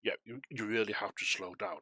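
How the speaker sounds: normal talking voice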